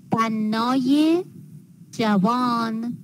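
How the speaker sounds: "بنای جوان" slowly, with -ye rising after bannâ; linking ezafe ye. Shown as a demonstration. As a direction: add a clear "ye" to بنای before جوان. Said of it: "می نویسیم".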